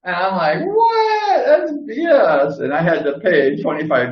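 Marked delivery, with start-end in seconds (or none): 0.6-2.6 squeaky, much higher angry cry of injustice; indignant shout. Retold or imitated. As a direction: yell at the unfair fine. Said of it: "And I was mad".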